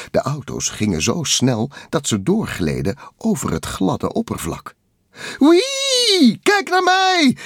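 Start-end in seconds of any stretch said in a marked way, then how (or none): 5.4-7.4 very high, loud whee; gleeful cry. A narrator's reading. Takel sliding on oil, high gleeful shout. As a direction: squeal out loud with glee. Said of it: "riep Takel uit".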